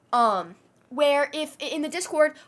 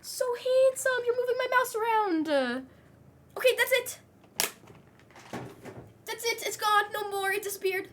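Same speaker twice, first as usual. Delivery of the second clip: much higher squeaky pet voice; 10 semitones higher than usual. Real, not acted. Owner scolds her cat for moving the mouse, high baby talk.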